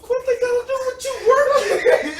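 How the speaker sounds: high, loud laughing retort; laughing outburst. Retold or imitated. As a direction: shout it through laughter. Laughing retold retort to supervisor.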